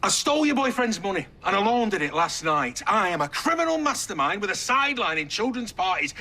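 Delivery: high-pitched, exasperated mock confession; hammed-up exasperated admission; sarcastic confession. Sarcastic false confession in row.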